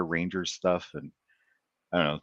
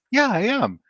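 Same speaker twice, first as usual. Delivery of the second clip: higher, louder, pleased player voice; eager player; 14 semitones higher, 5 dB louder, slower than usual. Retold or imitated. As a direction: play the flattered player, keen. Hockey player voiced in story.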